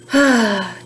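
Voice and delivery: weary sigh. Acted. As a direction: let out a tired sigh. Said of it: "Trixie sighs".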